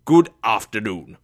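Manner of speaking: loud, firm send-off; curt dismissal. A narrator's reading. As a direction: end the talk loudly and firmly. Scrooge dismisses his nephew.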